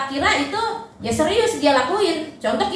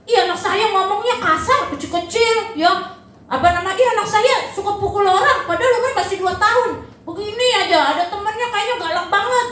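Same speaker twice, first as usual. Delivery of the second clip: higher, half-laughing mock complaint; complaining parent; 6 semitones higher than usual. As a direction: play a parent whining about their toddler. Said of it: "jangan ngeluh kalau misalnya".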